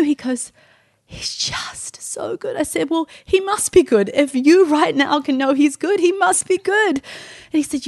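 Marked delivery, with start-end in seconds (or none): 1.1-2.6 slow, hushed, heartfelt whisper; awed whisper. Retold or imitated. Weeping husband's repeated praise. 2.9-7.0 high, emphatic, moved insistence; amazed conviction. Retold or imitated. Moved reply to grieving husband.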